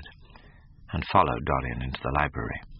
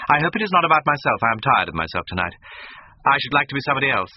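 much higher and louder, fast and sulky; petulant; 9 semitones higher, 6 dB louder, faster than usual. A narrator's reading. Sulking host complaining to his friend, petulant and fast. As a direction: toss it off like a bored, spoiled youth. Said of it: "in his petulant way".

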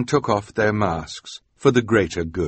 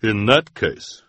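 deep-voiced woman; 4 dB louder, slower than usual. A narrator's reading. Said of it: "in her deep, deep voice".

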